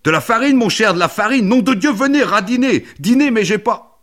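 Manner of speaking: very high, very loud excitement; frenzied, high-pitched yelling; ecstatic shouting. Acted. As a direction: shout the find as a wild discovery. Ecstatic discovery of flour.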